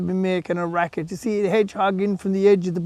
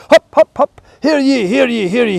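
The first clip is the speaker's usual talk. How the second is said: high, loud town-crier call; puck crier; 5 semitones higher, 8 dB louder than usual. Acted. Puck the goat announces the party, high and loud. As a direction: call the gathering like a loud herald. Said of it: "and he'd say".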